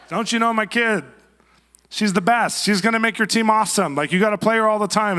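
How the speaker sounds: high, loud, insistent parent voice; pushy soccer mom. Retold or imitated. Soccer mom pressing the coach about her kid, loud and pushy. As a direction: play the bragging mom demanding playing time. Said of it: "soccer mom".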